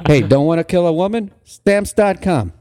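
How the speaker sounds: high announcer tagline, slowing on the brand; mock ad pitch. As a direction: sell it like a spoof commercial. Improvised mock advertising slogan.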